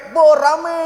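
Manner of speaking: mock excited buh; burst of noise. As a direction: stretch it out like a crowd erupting. Sanguine arrival acted out.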